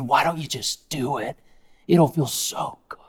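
tempter. Acted. Tempter to Jesus.

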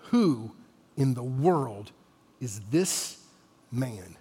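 low, soft question with long pauses; hushed awe. Acted. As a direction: ask it quietly, phrase by phrase, in awe. Question repeated in the hushed calm.

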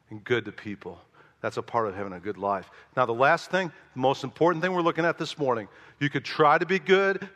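ordinary talk

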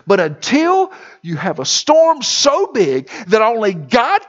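rising to a loud, high preaching climax; preaching at full force. Acted. Preacher building to a thundering peak.

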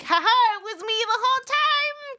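high, loud, hammed-up villain; creepy reveal voice. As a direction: gloat like a creep unmasked. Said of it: "so creepy if you were like".

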